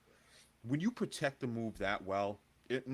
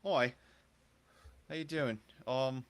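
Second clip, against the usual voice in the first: higher-pitched, tentative mock Scouse; Scouse accent attempt; 4 semitones higher than usual. Co-host challenged to do his best Scouse, hesitant mock Scouse accent. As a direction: badly attempt a Scouse greeting. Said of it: "give me your best Scouse accent".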